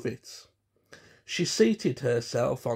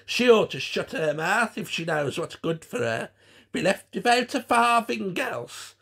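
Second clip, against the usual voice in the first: loud, high, coarse Cockney; Frau Vogelbauer; 7 semitones higher, 5 dB louder than usual. A narrator's reading. Puppet threatens Gwen to shut up, coarse Cockney cackle. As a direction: jeer like a cackling crone. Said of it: "in a coarse Cockney voice".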